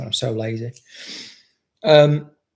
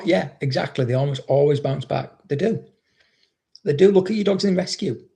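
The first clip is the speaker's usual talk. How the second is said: normal talking voice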